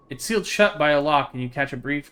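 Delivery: even, measured read-aloud of the story text; game text narration. Acted. Streamer reading game text to chat, steady narration. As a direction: read the scene out steadily to viewers.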